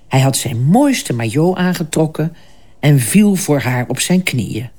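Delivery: plain reading voice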